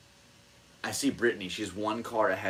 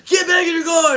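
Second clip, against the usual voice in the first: high, loud barked order; hammed-up official shouting orders; staff yelling; 14 dB louder than usual. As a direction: yell at them like gate staff. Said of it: "they're yelling at us".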